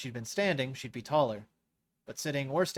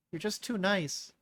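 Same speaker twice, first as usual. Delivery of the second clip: put-on higher, slow teasing girl; fond teasing; 7 semitones higher, slower than usual. Moira tells Naira she is too nice, fond. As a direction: chide her fondly. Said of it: "with another laugh".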